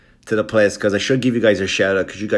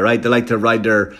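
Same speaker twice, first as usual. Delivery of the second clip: usual speaking voice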